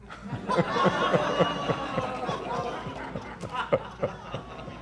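high, silent wheezing laugh; real airy laughter; breathless laugh. Real, not acted. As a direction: laugh without voice at the joke. After punchline.

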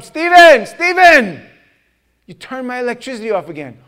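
high, loud shout of the name easing into complaint; shouted calling out. Retold or imitated. Preacher confronts Stephen about the electricity, shouted calling out. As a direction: call him out loudly, then complain.

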